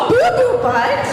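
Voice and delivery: high, loud laughing disbelief; giggly high double-take; mock shock. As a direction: gasp at the rude name. Mock shock at silly name.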